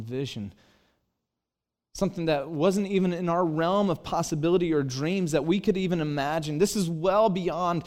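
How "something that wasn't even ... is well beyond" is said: passionate preaching. Acted. Pastor stirring up his congregation about the thrill of faith, fervent preaching. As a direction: build the crowd up with fervent joy.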